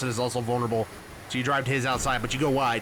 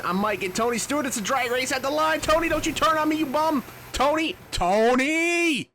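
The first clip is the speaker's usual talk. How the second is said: excited yelling; 12 semitones higher than usual. Real, not acted. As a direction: holler at the rival racer in rising panic. Racing rival at finish line.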